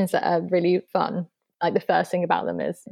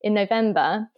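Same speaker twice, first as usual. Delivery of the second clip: ordinary talk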